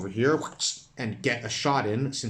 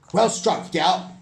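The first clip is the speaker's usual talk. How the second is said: much higher and louder cheer; hearty praise; 9 semitones higher, 9 dB louder, slower than usual. Acted. Character congratulating the elf, loud hearty praise. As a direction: hail the hero's blow.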